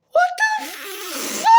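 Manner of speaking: very high, slow, laughing shout; laughing shriek. Real, not acted. A fan seeing Cyclops confirmed, laughing shriek. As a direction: squeal it out through laughter.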